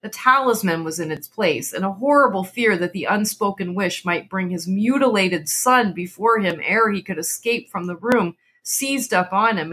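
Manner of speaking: ordinary delivery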